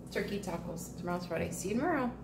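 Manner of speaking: ordinary talk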